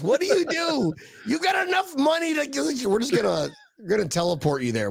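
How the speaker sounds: high mock shout. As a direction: shout it high, mocking the rich. Mock rich people voice.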